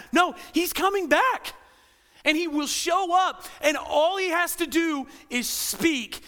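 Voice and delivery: slow, high, loud declaration; emphatic shout. Acted. Pastor answering the eye-rollers, slow emphatic shout. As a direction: proclaim every word like a herald.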